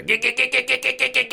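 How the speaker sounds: high stuttering g-g-g sounds; lightly mock an excited teen stuttering. Mocks teen masturbating over Carmen Electra.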